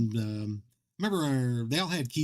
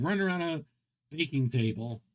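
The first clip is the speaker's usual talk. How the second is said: ordinary delivery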